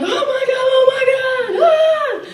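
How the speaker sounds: very high sing-song shrieks; shrieking crowd. Retold or imitated. Party guests shrieking, very high sing-song. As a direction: squeal it like a frenzied crowd. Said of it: "everyone's like".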